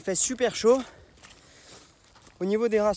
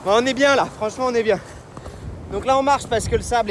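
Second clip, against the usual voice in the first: shouting over wind; 9 semitones higher, 6 dB louder than usual. Real, not acted. Running outdoors, loud and high.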